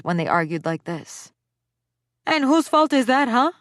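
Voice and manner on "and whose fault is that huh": high, loud, sharp retort; spat back. A narrator's reading. Mother spitting a retort at the father. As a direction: fire it back at him. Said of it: "she spat back".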